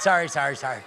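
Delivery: normal talking voice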